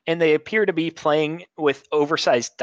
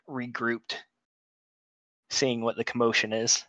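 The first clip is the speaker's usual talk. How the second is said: ordinary talk, nothing marked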